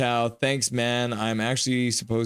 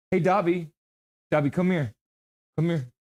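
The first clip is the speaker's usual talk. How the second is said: higher, coaxing call to the dog; 5 semitones higher than usual. Real, not acted. Owner calling his dog Dobby over, coaxing. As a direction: call him over brightly.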